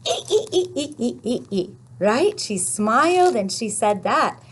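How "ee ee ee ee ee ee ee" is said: high "ee-ee" giggle, tapering off; Happy Y's giggle. Acted. Teacher answering what the character said, high giggle. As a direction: giggle on repeated long E sounds. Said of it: "what do you think she said".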